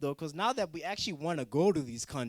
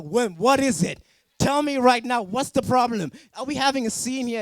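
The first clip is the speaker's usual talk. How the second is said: louder and higher, a hammed-up demand; mock confrontation; 5 semitones higher, 7 dB louder than usual. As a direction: get in the teacher's face, half joking. Confronting the teacher.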